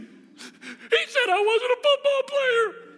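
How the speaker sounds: put-on high, slow, wailing kid voice; wounded little boy whining through tears; crying child voice. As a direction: sob it like a hurt little boy. Hurt child quoting brother.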